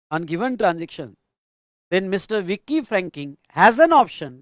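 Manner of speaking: very slow, each phrase said twice for writing down; slow dictation. Acted. Teacher dictating the case answer to students, slow and repeated. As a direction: dictate it phrase by phrase so the class keeps up.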